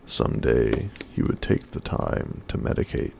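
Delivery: ordinary delivery, nothing marked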